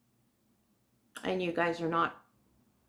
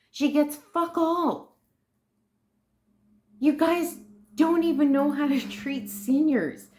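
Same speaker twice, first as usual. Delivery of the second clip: much higher and louder, slowed on the curse; furious yell; 9 semitones higher, 4 dB louder than usual. Real, not acted. Yelling at the government over seniors' care, furious. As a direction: shout it out at them. Ranting about mom's benefits.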